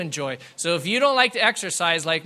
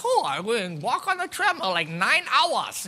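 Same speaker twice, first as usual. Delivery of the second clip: lightly voiced overenthusiastic viewer, swooping higher; mock binge-watcher; 5 semitones higher than usual. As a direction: play up a binge-watcher's reaction, stretching the end.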